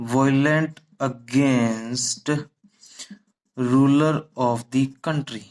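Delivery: very slow, word by word; slow dictation. Shown as a demonstration. Teacher dictates the English meaning word by word for notes. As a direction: dictate it so they can write.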